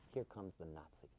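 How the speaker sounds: very low, hushed murmur; barely audible low aside; sly aside. Retold or imitated. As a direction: lean over and mutter it confidentially. Wife mimics friend's sly aside.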